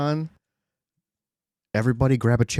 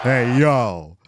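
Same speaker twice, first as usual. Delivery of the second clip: imitating; 7 dB louder than usual. Echoing the hey yo alert.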